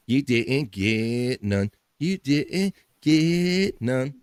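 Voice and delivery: slow, drawn-out schoolyard taunt; mocking sing-song tease; taunting kid from Eddie Murphy. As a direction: stretch every word to rub it in. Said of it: "one of my favorite bits from Eddie Murphy".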